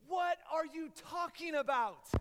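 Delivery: loud, high, drawn-out disbelief; incredulous. Retold or imitated. Young traveler reacting to missionary's answer, loud mock incredulity. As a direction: play up the baffled outburst.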